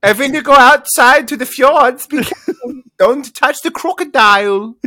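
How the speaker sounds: high, loud cartoonish guide voice; playful tour guide. Quizmaster imagining a guide warning of crocodiles, high and loud. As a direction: ham up a fussy fjord guide.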